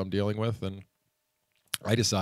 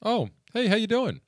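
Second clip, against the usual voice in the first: mock breezy greeting pitched much higher; nonchalant; 5 dB louder than usual. Imitating Dennis casually greeting the customer he ignored. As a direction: toss it off like nothing's wrong. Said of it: "all nonchalant".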